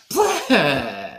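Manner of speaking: loud, high-pitched "blah" splatter noise; imitated gushing splat. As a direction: blurt a big comic spurt of stage blood. Imitates stage blood spraying out.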